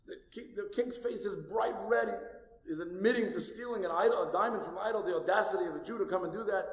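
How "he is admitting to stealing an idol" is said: normal talking voice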